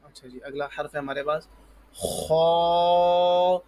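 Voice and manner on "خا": خا with a rasped throat kh and full, long vowel; heavy kha. Shown as a demonstration. Teacher models the letter for pupils, heavy drawn-out خا. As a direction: puff the mouth and hold خا long and loud. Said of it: "ایک تو یہ نکالیں اور موٹا کریں".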